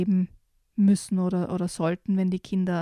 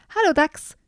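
higher, louder friendly hello; deer's greeting; 8 semitones higher, 4 dB louder than usual. Acted. Deer greets the badger, higher and louder friendly hello. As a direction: call out a bright higher hello. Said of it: "grüßte der Hirsch".

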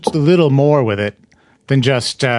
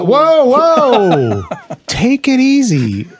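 excited; 11 semitones higher, 4 dB louder, much slower than usual. Real, not acted. Calming a co-host who jumped up.

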